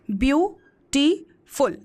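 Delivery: Beautiful split slowly into three separate beats; broken into syllables. Shown as a demonstration. Teacher counting syllables for students, beautiful broken slowly apart. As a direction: say beautiful syllable by syllable, pausing between. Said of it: "बोलने के लिए तोड़ना".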